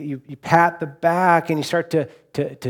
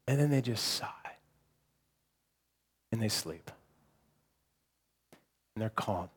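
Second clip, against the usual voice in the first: much softer, sinking into a whisper; hushed voice fading into a whisper, like not waking the sleeping baby; 9 dB quieter than usual. Acted. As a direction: soften and fade, as if a baby just fell asleep.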